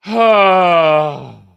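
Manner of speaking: long pained cry out; loud anguished groan. Real, not acted. Groans at embarrassing picture.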